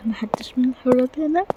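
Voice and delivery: hushed unvoiced whisper. Queen sneaking past absent guards, whispering. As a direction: whisper so the guards won't hear.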